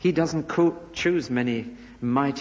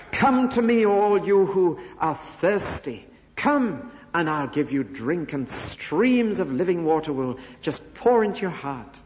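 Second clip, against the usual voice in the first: calling out; 5 semitones higher than usual. Retold or imitated. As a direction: proclaim it like an open call to a crowd. Preacher voices Jesus calling the thirsty.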